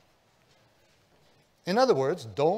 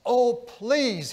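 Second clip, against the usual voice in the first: a higher, louder cry of pleading; begging; 8 semitones higher, 7 dB louder than usual. Retold or imitated. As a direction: plead with them for the boy's life. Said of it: "he begged".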